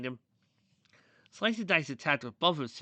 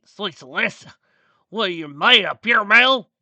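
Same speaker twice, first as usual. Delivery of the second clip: loud outburst building higher and louder; pained yelling; 9 semitones higher, 18 dB louder than usual. A narrator's reading. Lightning Wing after punching the monster, pained yelling. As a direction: holler it in shocked pain.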